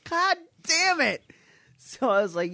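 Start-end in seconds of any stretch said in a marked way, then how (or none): 0.1-1.2 high, exasperated curse; frustrated curse. Retold or imitated. Replaying the fight that keeps glitching, exasperated.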